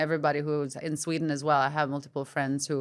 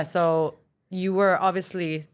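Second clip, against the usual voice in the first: usual speaking voice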